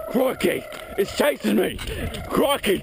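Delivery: loud, high panicked yelling; scared horror game streamer. Acted. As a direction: yell in mock terror while fleeing. Fleeing a "living" electrical tower.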